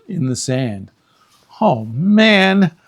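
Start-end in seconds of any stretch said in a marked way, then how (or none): 1.6-2.7 put-on high, loud boyish dismay; a boy groaning over a forgotten book. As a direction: realize the mistake out loud. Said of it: "he said".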